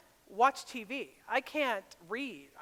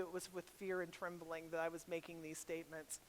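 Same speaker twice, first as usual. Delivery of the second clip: low, soft admission; confiding; 7 semitones lower, 15 dB quieter than usual. Real, not acted. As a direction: drop down low and confess it quietly. Confides her fear, dropping voice.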